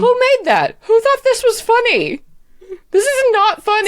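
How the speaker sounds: high, loud outraged diva; indignant drag queen. Acted. Sword Emily outraged.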